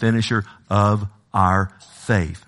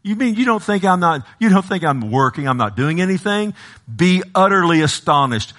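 God's challenge in a raised preacher's voice; higher, incredulous questioning, acted out; indignant divine reply; 8 semitones higher than usual. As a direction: answer the doubter with force.

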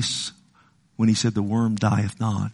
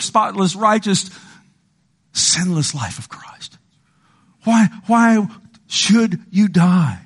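raised, impassioned sermon voice; fervent preaching; 7 semitones higher than usual. Acted. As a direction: plead God's call to the congregation. Preaching at full force.

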